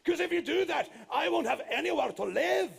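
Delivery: high, loud panicked no's; yelling. Acted. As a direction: shout him down in a panic. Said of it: "yelled the giant".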